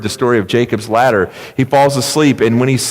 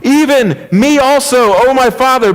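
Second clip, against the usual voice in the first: tearful plea; 12 semitones higher, 5 dB louder than usual. Acted. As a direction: beg father with a touch of tears. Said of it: "You can imagine tears in his eyes".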